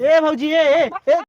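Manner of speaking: short, loud hailing shouts; calling after her. Acted. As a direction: call out to get her attention. Calling after sister-in-law walking off.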